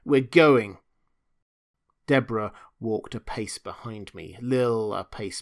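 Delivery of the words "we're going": barely contained rage. Acted. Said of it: "her rage barely in check".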